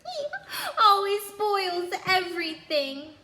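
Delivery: high, loud whiny pretend crying; fake sobbing. A narrator's reading. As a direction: whine it through crocodile tears. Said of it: "between the huge pretend sobs".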